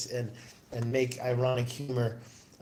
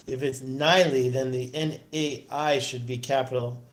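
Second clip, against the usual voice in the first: ordinary delivery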